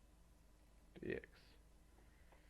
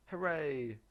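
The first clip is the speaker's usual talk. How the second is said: loud happy hooray; excited cheer; 5 semitones higher, 10 dB louder than usual. Real, not acted. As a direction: cheer the correct answer. Celebrates getting the right answer.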